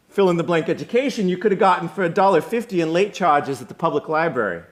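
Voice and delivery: loud, high, rapid-fire tirade; scornful dressing-down. Acted. Will lectures the student on his wasted tuition, loud scornful rant. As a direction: lay into him without letting up.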